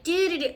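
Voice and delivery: high, singsong "do-do-do"; happily pottering in your own world; oblivious little ditty; carefree humming. Acted. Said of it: "they're in their own world".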